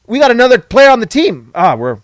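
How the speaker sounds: ordinary talk, nothing marked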